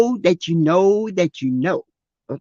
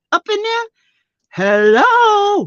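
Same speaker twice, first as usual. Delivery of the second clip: mock astonishment; 12 semitones higher, slower than usual. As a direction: voice shoots high in mock shock. Mock shock at big toy.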